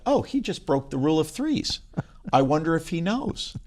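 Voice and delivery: lifted, playful thinking-to-himself voice; mock aside. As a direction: voice a silent critic's little observation. Acts out audience member's private reaction.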